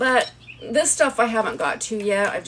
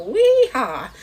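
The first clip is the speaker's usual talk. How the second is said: excited; 5 semitones higher, 7 dB louder than usual. Real, not acted. Cheering as handle clicks in.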